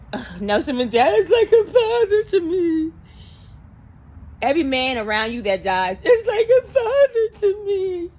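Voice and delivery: mocking Naomi. Host mocks Naomi calling every dead man a father, high whiny lament. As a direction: overplay the sorrow, mocking her. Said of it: "But then you said".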